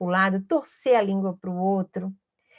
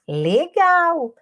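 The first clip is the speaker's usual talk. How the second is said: praise; 6 semitones higher, 4 dB louder than usual. Retold or imitated. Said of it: "disse ainda que gostou".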